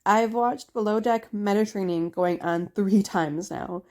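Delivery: usual speaking voice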